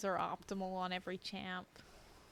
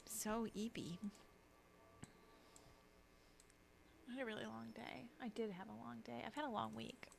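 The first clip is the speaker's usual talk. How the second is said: soft, weary admission; sleepy; 10 dB quieter than usual. Real, not acted. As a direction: let the voice sink, worn out from a long week. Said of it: "I'm eepy".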